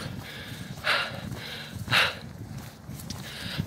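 long breathless exhale; heavy panting breath. Real, not acted. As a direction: catch your breath audibly. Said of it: "let me just get my breath back".

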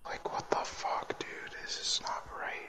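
voiceless, hushed dismay; uneasy whisper. Real, not acted. Player creeping through an eerie game, uneasy whisper. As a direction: whisper it as if something might hear.

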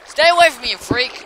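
very high, loud frightened yell; panicked scream. Real, not acted. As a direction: scream at the monster to back off. Fleeing the ghast.

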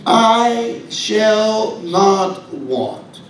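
each word "I shall not want" drawn out very slowly; very slow reading. Shown as a demonstration. Preacher quoting the psalm, very slow and deliberate. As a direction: let every word of the quote hang. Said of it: "He says".

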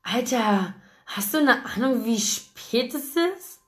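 sleepy Ethan grumbles, mildly voiced; grumbling, woken up. A narrator's reading. Friend woken at night grumbles at caller, mildly grumpy. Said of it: "brummte er missmutig".

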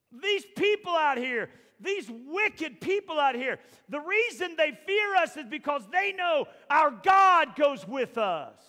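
loud, high, drawn out; Moses pleading in full cry. Acted. Moses begging God to come along, loud. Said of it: "Moses ... goes".

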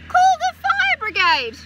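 high, loud urgent call for help. A narrator's reading. Reader calling for the fire brigade, high and loud.

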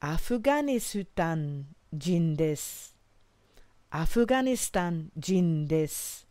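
drawn-out careful Japanese phrase; slow model phrase. Shown as a demonstration. Teacher modelling Kanada jin desu slowly for learners. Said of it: "you would say".

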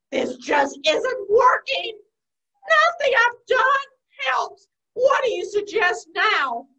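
slow, loud, fed-up complaint; exasperated. Acted. Spider demands help from Wise Owl, exasperated. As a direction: stretch out every frustrated word.

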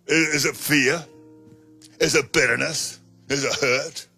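ordinary talk

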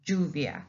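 "lluvia" starting with a j sound; Spanish double L. Shown as a demonstration. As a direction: say "lluvia" as JOO-vya. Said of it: "and we pronounce it ja".

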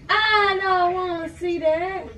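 high, slow shriek of disgust; shrieking refusal. Real, not acted. Refusing gross video.

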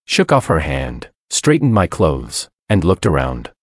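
ordinary delivery

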